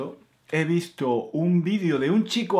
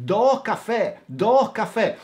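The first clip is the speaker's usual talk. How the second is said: louder Andalusian "Dos cafés", no final S, said twice; repeating in Andalusian; 7 semitones higher, 5 dB louder than usual. Retold or imitated. Student repeating his order to a waiter who doesn't understand, louder Andalusian accent. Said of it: "tenía que repetir las frases".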